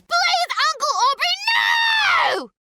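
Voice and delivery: very high, loud terrified plea; girl begging her uncle not to bury her, screaming; desperate pleading scream. Acted. Girl dragged to grave pleading.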